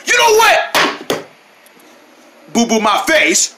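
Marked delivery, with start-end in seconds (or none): 0.0-1.3 high, slow outburst; acted exasperation. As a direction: stretch it out as patience snaps. Fed up with the teasing.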